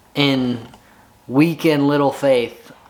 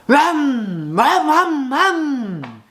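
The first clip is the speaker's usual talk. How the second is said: engine noise; 13 semitones higher, much slower than usual. Retold or imitated. Imitating the engine finally starting.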